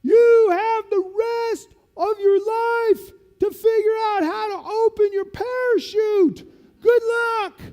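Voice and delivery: slow, high, loud teasing shout; mock sergeant. Sergeant teasing lieutenant before pushing him, loud and slow. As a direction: savor the last-second news.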